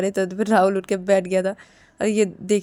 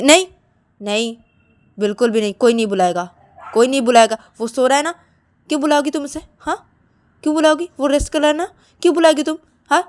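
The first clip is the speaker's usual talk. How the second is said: loud, insistent scolding climbing in pitch; scolding refusal; 6 semitones higher, 8 dB louder, faster than usual. Acted. Shruti forbids calling her resting brother, scolding. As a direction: forbid it, louder and higher as you press.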